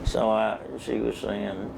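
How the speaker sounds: usual speaking voice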